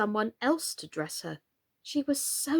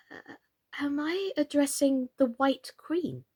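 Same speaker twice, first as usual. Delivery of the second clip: timid; 7 semitones higher than usual. A narrator's reading. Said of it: "So she began rather timidly".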